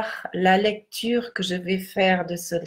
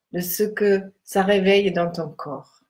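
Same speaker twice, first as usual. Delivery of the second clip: guided meditation. Acted. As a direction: guide them gently into stillness.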